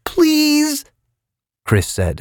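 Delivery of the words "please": high, desperate plea; pleading. A narrator's reading. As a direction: beg desperately. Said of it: "his voice was pleading".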